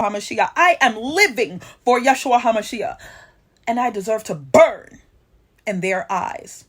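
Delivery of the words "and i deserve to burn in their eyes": ordinary talk, nothing marked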